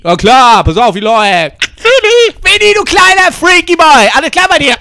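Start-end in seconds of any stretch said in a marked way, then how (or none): 1.8-4.8 high-pitched, loud cartoonish greeting; high silly character voice, shouted hello; squeaky Winnie voice. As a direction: call out to Winnie in a squeaky put-on voice. Friends greeting Winnie in sketch.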